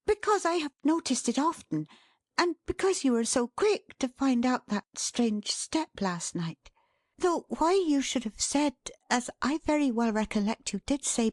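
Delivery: high, nervous running talk; flustered Dot chattering on. Acted. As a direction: keep talking to hide a pounding heart. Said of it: "talking on as fast as she could to hide its palpitating state".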